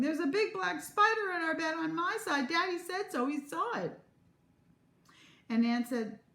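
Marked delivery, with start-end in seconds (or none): 0.0-4.0 high-pitched panicky yell; high, frantic complaint; alarmed yelling. A narrator's reading. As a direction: yell it as a squealing, alarmed mother.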